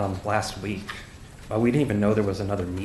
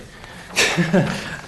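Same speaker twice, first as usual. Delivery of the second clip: nervous laugh; 9 semitones higher than usual. Real, not acted. Nervous speaker at public meeting.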